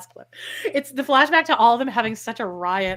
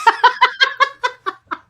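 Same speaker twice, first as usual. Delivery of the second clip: high loud laughter; 14 semitones higher, 8 dB louder than usual. Real, not acted. Laughing at the "have jobs" jab, high loud cackle.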